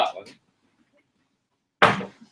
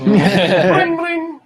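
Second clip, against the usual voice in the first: phone ring; 5 semitones higher, 4 dB louder than usual. Acted.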